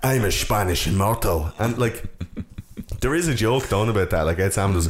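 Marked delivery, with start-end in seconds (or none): 0.0-1.5 put-on slow, Scottish-tinged Spanish accent; mock Connery attempting Spanish; Connery's fake Spanish accent. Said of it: "learning how to do a Spanish accent".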